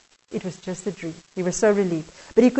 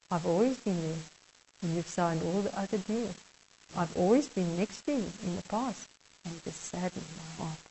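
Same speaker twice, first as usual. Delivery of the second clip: much softer, grieved and tender; sorrowful Jesus; 5 semitones lower, 14 dB quieter than usual. Acted. Jesus tells the kneeling man his deals saddened him, softly grieving. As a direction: let the sadness sink into a near hush.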